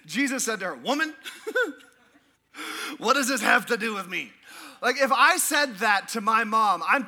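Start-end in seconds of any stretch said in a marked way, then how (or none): 0.7-4.3 laughing quote. Retold or imitated. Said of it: "I get a kick out of this".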